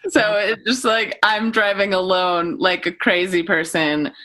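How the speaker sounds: ordinary talk, nothing marked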